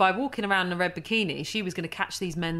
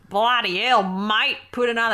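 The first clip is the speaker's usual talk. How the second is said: hammed-up Australian twang, pitched up; exaggerated Australian accent; 3 semitones higher, 5 dB louder than usual. Mimicking co-host's bad impression, exaggerated Australian accent. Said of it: "a really exaggerated Australian accent".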